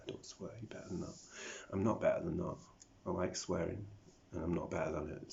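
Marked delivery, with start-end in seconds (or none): 0.1-1.2 softly voiced inner reprimand; self-scolding voice. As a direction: chide yourself under your voice, lightly acted. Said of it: "I don't wanna swear".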